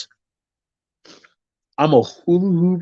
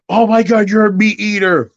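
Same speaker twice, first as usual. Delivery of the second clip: high, louder scandalized gasp; mock outraged vegan; 8 semitones higher, 3 dB louder than usual. Mocking preachy vegans, high scandalized cry. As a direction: act appalled at a meat eater. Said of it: "people who try to throw it in my face".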